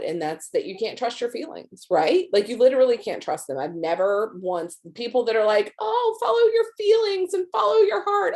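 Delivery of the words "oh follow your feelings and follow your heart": very high, sing-song mock advice; mocking imitation. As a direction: parrot dreamy advice in an airy high voice. Mocking people who give advice.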